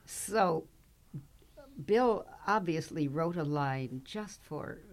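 ordinary talk

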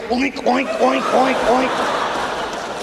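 a hint of piglet oinking, trailing into a laugh; pig oinks. Randy at breakfast oinking on cue, lightly voiced. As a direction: oink along eagerly. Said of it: "he would go".

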